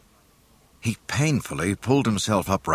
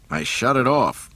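sharp; 7 semitones higher, 4 dB louder than usual. Acted. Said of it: "Han said sharply".

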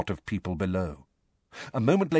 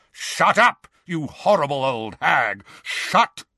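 loud, raised-pitch furious bellow; explosive angry shout; roaring; 6 semitones higher, 4 dB louder, slower than usual. A narrator's reading. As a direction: roar at her to be silent. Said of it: "he roared".

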